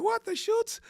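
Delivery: high mock disbelief. Mock reaction to parents.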